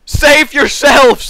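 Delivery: loud, high, drawn-out shout; hammed-up cry to the fleeing troops; mock panic. Acts fleeing French in panic.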